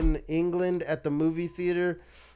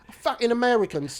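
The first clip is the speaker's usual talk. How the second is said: higher-pitched mock British curse; attempted British accent; 8 semitones higher than usual. Storyteller imitating the cleaner cursing foreigners, British accent. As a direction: grumble as an annoyed Brit, pitched up. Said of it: "Is that the accent?".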